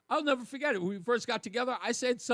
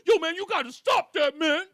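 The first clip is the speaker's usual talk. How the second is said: loud, higher, teary whine; mock upset plea; 7 semitones higher, 8 dB louder than usual. Host imitating an upset caller, loud and teary. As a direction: whine it like it hurts. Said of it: "[name] does Negro nonsense".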